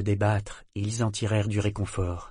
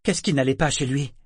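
fearful; 5 semitones higher, 4 dB louder than usual. A narrator's reading. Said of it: "d'une voix où perçait la crainte".

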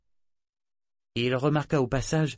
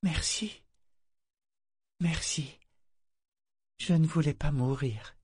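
remerciement faible; 6 semitones higher than usual. A narrator's reading. Garçon délirant, soulagé, épuisé.

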